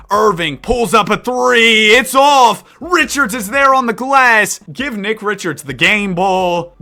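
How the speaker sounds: live sports commentary, rising with the action; energetic announcer call; play-by-play. Acted. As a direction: call the game like a broadcaster. Live play-by-play of game sim.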